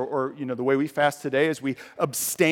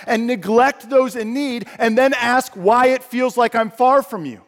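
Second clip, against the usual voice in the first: slow, loud, higher voice of God; God scolding his people from the pulpit, loud and slow; God rebuking; 9 semitones higher, 7 dB louder, slower than usual. Acted. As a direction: thunder God's rebuke slowly.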